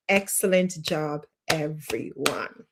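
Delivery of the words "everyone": ordinary talk, nothing marked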